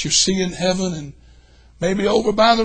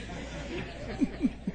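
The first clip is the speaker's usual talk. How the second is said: high laugh; 10 semitones higher, 12 dB quieter than usual. Real, not acted. Laughs after punchline.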